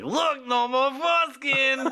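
very high and slow, loud; drawn-out high character voice; squeaky caricature voice. Acted. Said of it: "he's like".

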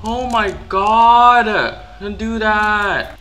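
high loud slow oh my god; startled shout. Real, not acted. Gamer startled by the animatronic, yelling. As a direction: shout at the scare.

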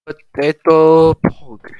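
loud, very slow, drawn-out cheer of "Potato pog."; loud, slow, happy cheer over the potatoes; excited cheer. Real, not acted. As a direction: stretch it out in delight. Thrilled about baked potatoes.

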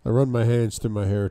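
usual speaking voice

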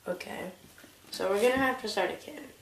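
ordinary talk

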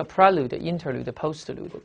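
"prelude、interlude、postlude", each stressed on its first syllable. Shown as a demonstration. Teacher shows students where "prelude, interlude, postlude" take stress, first-syllable stress. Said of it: "重音都在最前面".

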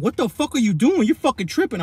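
high, loud mock girlfriend demanding answers; baby mama yelling. Baby mama yells at him in the shower, high and loud. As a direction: pitch it up and push like an annoyed girlfriend. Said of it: "my B M's like".

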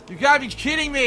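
loud, high exasperated yell; frustrated shout. Real, not acted. Gamer shouting at his stuck character in real frustration. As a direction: blurt it out in real disbelief.

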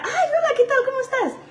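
high, bubbly mock greeting with a laugh; cheery waitress voice. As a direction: play the sugary waitress greeting tables.

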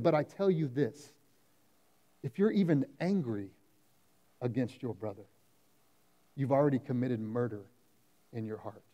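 quiet, grave delivery of Jesus' words; hushed quoting. Retold or imitated. Preacher quoting Jesus to the church, softly and gravely.

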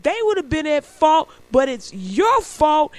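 high, loud mock scolding; mad cop. Cop blaming the pedestrian, mad and emphatic. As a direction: lean hard on the blame, drawing it out. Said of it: "The cop is turning red, mad".

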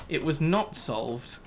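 ordinary delivery

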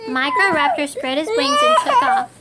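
narrating the flight, thrilled; thrilled narration. A narrator's reading. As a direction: sweep into the take-off. Flight scene.

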